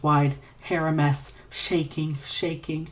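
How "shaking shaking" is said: ordinary delivery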